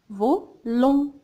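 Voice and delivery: "Volant" split in two, vo, lant; syllable by syllable. Shown as a demonstration. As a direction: sound out "Volant" with a gap before lant. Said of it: "Oui, j'entends en". (« Volant » découpé en deux syllabes : vo, lant)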